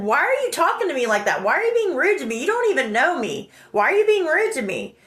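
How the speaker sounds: high-pitched, swooping indignant questions; retold protest. Asking the friend why he's rude, indignant. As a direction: protest the rudeness insistently, acted out.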